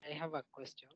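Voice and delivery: very faint, far from the mic; almost inaudible speech; barely audible. Real, not acted. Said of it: "Your voice is very faint".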